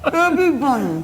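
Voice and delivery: marshmallows packed in cheeks; mushy words through a full mouth; cheeks stuffed full. Real, not acted. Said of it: "Put it in your mouth and say".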